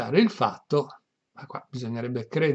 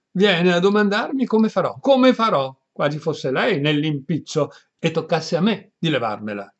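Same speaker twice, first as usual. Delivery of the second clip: louder, higher exasperated grumble; exasperated complaint; 5 semitones higher, 8 dB louder than usual. Acted. Priest complains that his housekeeper only asks, exasperated louder rant. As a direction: throw the question back at her. Don Abbondio complains angrily.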